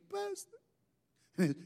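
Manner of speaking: high drawn-out Puerto Rican "Pastor", falling slowly; a grieving grandson's Puerto Rican accent, pitched high. Retold or imitated. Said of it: "His Puerto Rican".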